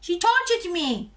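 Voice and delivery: high, squeaky little pet voice, complaining; high, put-on voice of a fussy little creature; baby animal voice. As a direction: speak for the fed baby as if it's telling on you. Voicing the fed baby animal.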